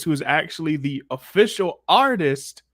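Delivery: ordinary delivery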